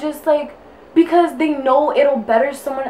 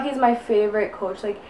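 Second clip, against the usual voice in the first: ordinary talk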